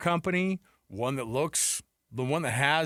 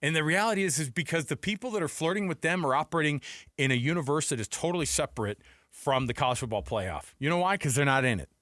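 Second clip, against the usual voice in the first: ordinary talk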